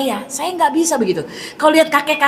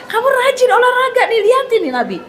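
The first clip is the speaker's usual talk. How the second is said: much higher, playful nudge with a laugh; laughing at kids; 11 semitones higher than usual. Retold or imitated. Mother teasing her children about exercise, laughing and higher. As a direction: tease the kids toward exercise. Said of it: "saya bilang sama anak-anak".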